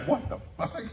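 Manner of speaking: high, loud, stunned outburst; shocked coach. Retold or imitated. Dad imitates a stunned coach hearing his kid rap. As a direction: blurt it out, floored. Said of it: "He's like".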